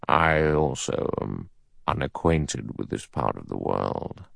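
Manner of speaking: low, soft judge voice; cautious judge. A narrator's reading. Judge answers fellow passenger, low and cautious.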